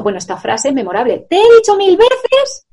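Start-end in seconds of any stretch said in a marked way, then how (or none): 1.3-2.7 high, loud mock shout at the child; shouting parent. A parent shouting at a child not to shout, mock yell.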